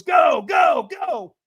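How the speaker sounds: high, loud, repeated send-off; trainer's urging shout. Retold or imitated. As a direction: yell to launch someone forward. Trainer sending him onto coals.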